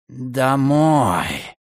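hissed, slow falling command. A narrator's reading. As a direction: hiss the word through the teeth. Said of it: "прошипел Рейден". (прошипеть)

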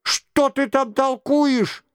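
high, outraged royal exclamation; crying out. A narrator's reading. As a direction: burst out as an insulted king. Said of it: "вскричал Генрих".

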